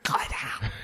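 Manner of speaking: a hushed command so nobody wakes; quiet whispered go lay down. Retold or imitated. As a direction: whisper the order to the dog. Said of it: "I'm like".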